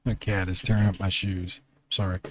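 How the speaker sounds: quiet aside. Real, not acted. Aside about cat.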